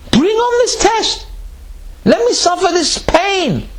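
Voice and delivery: high, slow, eager cry for the test; eager plea. Pretend. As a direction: beg for the trial as if it were a gift. Preacher voices eager believer.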